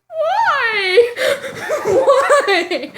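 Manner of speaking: loud, high, hammed-up sobbing cry; despairing wail. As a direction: howl in melodramatic disbelief. Wails after mom hangs up.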